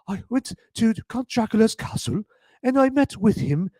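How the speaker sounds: high-pitched, badly faked British accent; terrible British accent. Imitating Keanu in Dracula, terrible high British accent. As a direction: do a hammy, awful British accent. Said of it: "what a terrible British accent".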